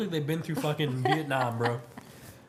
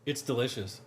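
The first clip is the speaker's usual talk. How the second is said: unenthusiastic; 4 semitones lower, 3 dB quieter than usual. Real, not acted. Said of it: "Why are you saying it like that?".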